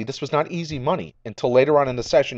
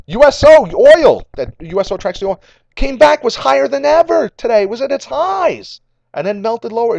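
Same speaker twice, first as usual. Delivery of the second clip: higher and louder, tumbling out breathlessly; excited rush; 10 semitones higher, 6 dB louder than usual. Real, not acted. Vlogger recapping a wild oil rally, breathless and excited. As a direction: rattle it off until out of breath. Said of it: "ooh, I'm out of breath".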